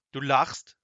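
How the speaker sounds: loud, startled challenge; wizard's loud cry of a question; indignant call. A narrator's reading. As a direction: call it out in disbelief. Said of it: "rief der große Zauberer".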